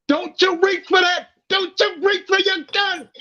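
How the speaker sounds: high-pitched mock movie yell; high, strained quote from a movie scene; yelled movie line. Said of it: "you just yelled out a line".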